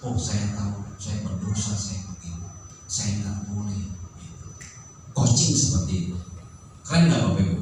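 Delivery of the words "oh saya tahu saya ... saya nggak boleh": low and soft, admitting fault; a child realizing its mistake, softly; softer, lower voice of remorse; quiet remorse. Acted. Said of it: "timbul kemauannya dari dalam".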